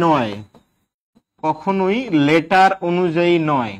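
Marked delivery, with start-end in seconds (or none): 1.4-3.7 slow and deliberate, each word spaced for note-taking; slow dictation. Shown as a demonstration. Teacher dictating a rule slowly to note-taking students. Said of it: "এই জন্য শুনে লিখবেন".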